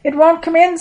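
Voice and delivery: high, loud storybook exclamation; excited straining protest. A narrator's reading. Thomas to Peter at the heavy net, calling out excitedly. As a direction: call back urgently, high and loud. Acted struggle with the full net.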